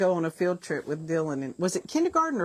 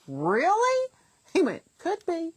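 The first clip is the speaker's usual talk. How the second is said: put-on much higher, squeaky kid voice; a little boy shrugging off the question; imitating a child; 12 semitones higher, 3 dB louder, slower than usual. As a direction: son admits he may be making it up. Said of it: "He goes".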